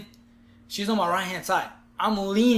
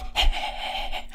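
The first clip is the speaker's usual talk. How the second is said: fast, unvoiced panting; mimicking a dog's breathy pant; 4 dB quieter than usual. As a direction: pant like an eager dog. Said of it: "I'm like a dog".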